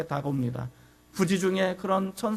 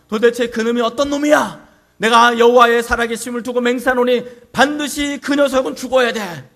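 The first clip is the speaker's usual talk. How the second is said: loud, raised voice of an outraged David; enraged king's outcry; 4 semitones higher, 8 dB louder than usual. Acted. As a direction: roar out a furious royal oath. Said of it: "불같이 화를 내기 시작합니다".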